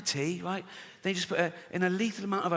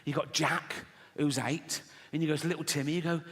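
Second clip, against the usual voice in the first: normal talking voice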